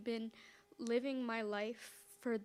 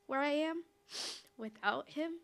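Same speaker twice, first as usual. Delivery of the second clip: voice breaking; 5 semitones higher, 3 dB louder than usual. Real, not acted. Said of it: "I've been a bit emotional".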